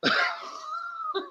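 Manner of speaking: a sudden high, breathy laugh; high squealing laugh. Real, not acted. Laughing at his own punchline, high and squealing.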